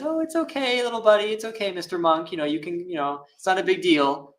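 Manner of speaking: put-on very high, cooing baby talk to a monk; mock babying. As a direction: coo it like soothing a toddler. Mock soft Buddha consoling monk.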